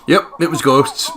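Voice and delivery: mock police officer. Joking about police confirming ghosts, mock official announcement. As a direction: announce it like a bored cop. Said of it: "the police were actually like".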